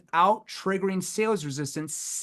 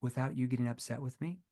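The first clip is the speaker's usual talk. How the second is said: soft, concerned, much softer; leaning in; 5 semitones lower, 8 dB quieter, faster than usual. Shown as a demonstration. Salesman leaning in to the prospect, soft and concerned. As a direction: say it close and gently. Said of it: "where I leaned in and I said".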